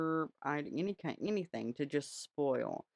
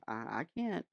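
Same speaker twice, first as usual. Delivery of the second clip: ordinary delivery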